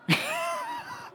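very high squealing laugh; squealing laugh at his own joke. Real, not acted.

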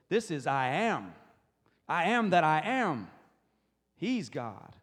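loud, declamatory preaching, weight on each "am"; full-force declaration of God's name; booming proclamation. Acted. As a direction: thunder it out in awe.

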